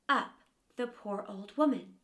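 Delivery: ordinary delivery, nothing marked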